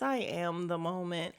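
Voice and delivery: low, soft, composed imitation; calm. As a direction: keep it quiet and unruffled. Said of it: "she's calm, just like this".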